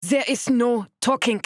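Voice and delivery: sharp, loud, high German snap; snapping. Acted. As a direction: bite their heads off. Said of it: "Missus Koenig snapped at the two of us".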